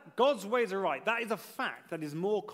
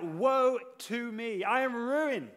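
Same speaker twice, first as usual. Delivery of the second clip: slow, raised cry of a prophet, retold; imitated anguished outcry, pitched up; 4 semitones higher, 4 dB louder, slower than usual. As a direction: draw it out in a higher preacher's cry. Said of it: "And he cried out".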